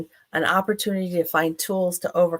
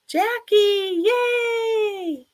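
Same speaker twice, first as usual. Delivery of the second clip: high, drawn-out happy cheer; delighted greeting; 3 dB louder, much slower than usual. Real, not acted. Host spots Jackie joining and cheers, delighted greeting. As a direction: sing out a thrilled welcome, much higher.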